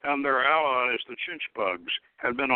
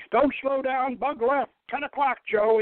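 high, sharp commands barked fast; barked orders; 8 semitones higher than usual. Acted. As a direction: bark the orders at your crew. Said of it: "Enoch barked".

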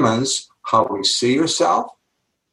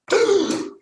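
high, sharp mock gasp; shocked gasp. Teacher told by the dean he's the math professor, shocked gasp. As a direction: gasp as if handed terrible news. Retold shock at dean's job offer.

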